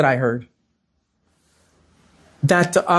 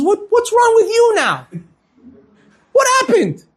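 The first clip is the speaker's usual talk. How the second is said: very high-pitched, incredulous questioning; exasperated alarm; 14 semitones higher than usual. Retold or imitated. Manager finding his partner crying, exasperated and alarmed. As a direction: act out a baffled demand for answers.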